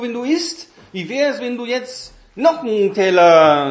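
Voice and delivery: deutlich höhere, schwärmerische Teufelsstimme; schwärme vom Essen, um zu verführen; verführerischer Teufel. Retold or imitated. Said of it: "der Teufel sagt". (Teufel lockt zum Überessen, hoch und schwärmerisch)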